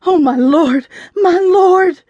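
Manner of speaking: high, wailing lament; quivering wail. A narrator's reading. Mama beside the window, quivering and wailing. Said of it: "quivering and wailing".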